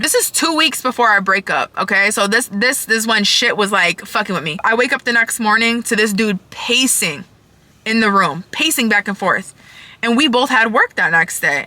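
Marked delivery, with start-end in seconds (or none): none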